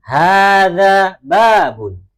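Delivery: slow, loud, drawn-out Arabic, every syllable of "Hada masjidun" stretched; slow model reading. Shown as a demonstration. Teacher modelling Arabic for the class, slow loud recitation. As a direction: chant it slowly for the class to repeat. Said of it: "kita baca bersama-sama".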